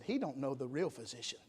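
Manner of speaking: soft, tender hush; gentle nurse. Retold or imitated. A nurse softly comforting a frightened wife, tender and quiet. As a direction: soothe her like a kind nurse.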